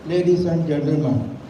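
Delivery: ordinary delivery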